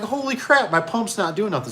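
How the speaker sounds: very high, exasperated cry; hammed-up dismay at the dead pump; shocked. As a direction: voice jumps way up in disbelief. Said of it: "I'm like".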